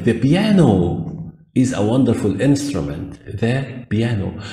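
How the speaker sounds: slow, careful English; teacher dictation; slow example sentence. Shown as a demonstration. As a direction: model the sentence slowly. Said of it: "لما أقول لك".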